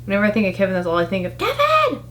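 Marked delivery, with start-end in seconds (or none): 1.4-2.0 high shrieked name like a frantic mom; Home Alone mom scream. Retold or imitated. Mom in Home Alone shrieking for her son, high scream. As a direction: scream the name, much higher. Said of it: "That mom screaming her child's name".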